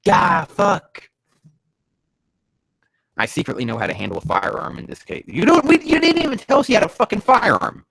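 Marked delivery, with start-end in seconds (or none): 0.0-1.1 high, loud yelp; sudden pained-sounding exclamation; startled curse. Acted. 5.3-7.8 high, shouted protest; loud indignant outburst, voice pitched way up; outraged complaint. Real, not acted. Outraged at an unannounced firearm.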